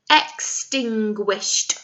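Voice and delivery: "Extinguished" drawn out syllable by syllable; slow syllable by syllable. Shown as a demonstration. Teacher models "Extinguished" again for children, very slow. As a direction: stretch out "Extinguished" for them to repeat. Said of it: "Can you say that new word?".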